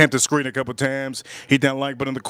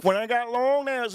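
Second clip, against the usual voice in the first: higher-pitched mock woman; stripper imitation; 7 semitones higher than usual. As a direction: imitate her, lightly raised pitch. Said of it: "she goes".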